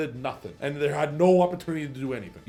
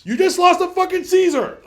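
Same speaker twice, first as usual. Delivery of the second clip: much higher, louder outraged yell; exasperated shout; 9 dB louder than usual. Real, not acted. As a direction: blow up at the misplay. Ranting about a misplay.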